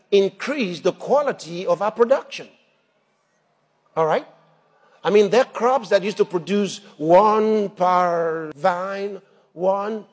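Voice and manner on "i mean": ordinary delivery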